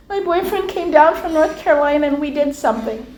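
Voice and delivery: mildly imitated crying voice; retold tearful confession. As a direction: confess it as if close to tears. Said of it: "and she's crying".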